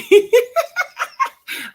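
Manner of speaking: big high burst of laughter; high-pitched laugh bursting out; loud laugh. Real, not acted. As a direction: crack up loudly. Laughs at creep remark.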